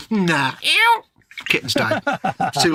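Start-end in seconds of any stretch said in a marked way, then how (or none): 0.6-1.0 high, loud strangled squeal of a dying kitten; kitten dying noise. Acted. Host acting out a kitten dying for viewers, high strangled squeal. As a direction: squeal like a kitten breathing its last. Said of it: "really good kitten dying noise".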